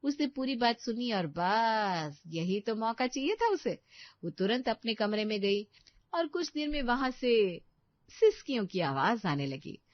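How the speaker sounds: low, calm storyteller narration; story narration. A narrator's reading. Narrator telling listeners what Preeti overhears. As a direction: narrate the scene steadily.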